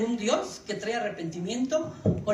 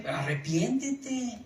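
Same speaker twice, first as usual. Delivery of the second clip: usual speaking voice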